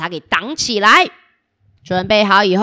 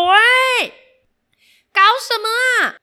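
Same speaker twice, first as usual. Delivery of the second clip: angry villain; 5 semitones higher than usual. Acted. Villain finds prison empty.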